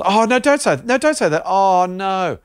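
high panicked pleading. Acted. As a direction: beg them to stop, high and anxious. Panicked marketing team voice.

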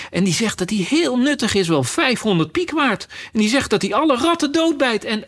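high, eager gushing; rising enthusiasm, tumbling from detail to detail; enthusiastic. Acted. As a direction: chatter on excitedly, carried away. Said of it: "ging Alice enthousiast verder".

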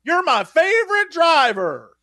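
high, slow, cheery robot announcer; Lightning McQueen animatronic greeting kids at the ride; animatronic car voice. Retold or imitated. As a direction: play a canned theme-park animatronic line. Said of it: "he'll randomly say things like".

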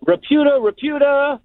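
sister-in-law teasing the guest, mock high call; mimicking the sister-in-law. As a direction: imitate her cheeky high-pitched taunt at the rock star. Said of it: "my sister-in-law looks at him and goes".

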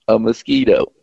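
ordinary delivery

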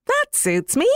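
a pleased bird crying out its approval; starling voice. Acted. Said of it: "cried the starling".

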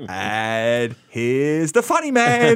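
very slow, solemn mock intro; hammed-up deadpan introduction; mock grave announcement. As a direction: drag it out like a funeral announcement. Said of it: "And then you go".

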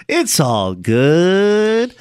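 slow mimicry with long, stretched vowels; imitated drawn-out delivery. Homeless man saying he won't retaliate, imitated in a slow drawl. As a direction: stretch out "all" and "good" as an impression. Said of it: "That's how he said it".

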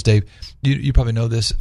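ordinary talk

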